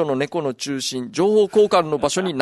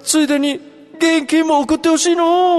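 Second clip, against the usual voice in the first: put-on old man voice; 10 semitones higher, 8 dB louder than usual. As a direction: play a doddering grandpa. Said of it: "かっこおじいさん風で".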